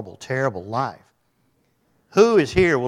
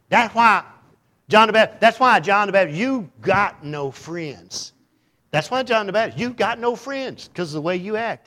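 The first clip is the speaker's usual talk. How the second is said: teasing scold; 8 semitones higher, 6 dB louder than usual. Acted. Teasing John the Baptist.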